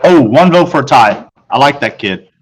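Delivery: plain reading voice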